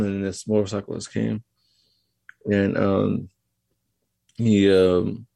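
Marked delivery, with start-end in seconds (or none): none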